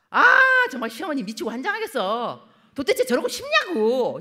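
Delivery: loud, rising, fed-up complaint; a wife venting about her mother-in-law in the car; exasperated wife. Retold or imitated. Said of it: "아내가 그러는 거죠".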